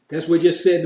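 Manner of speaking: normal talking voice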